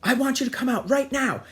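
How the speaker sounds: high, loud retold shouting; mock furious father. Father ordering son out of bathroom, mock furious. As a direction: imitate a dad bellowing at the door.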